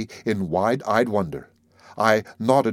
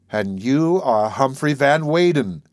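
slow, awed and grave; solemn awe; 3 semitones higher, slower than usual. Acted. Maud gazing back at the narrator, solemn and awed. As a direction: hold each word with wonder. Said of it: "with equal solemnity and awe".

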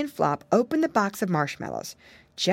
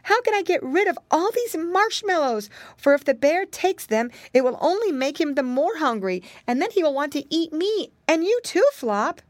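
put-on high-pitched, fretful child voice; distressed little girl; 10 semitones higher than usual. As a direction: plead your problem as a worried girl.

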